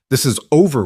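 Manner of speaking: high and loud final word; firm. Acted. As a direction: shut it down, hard on over. Samuel ending the fight.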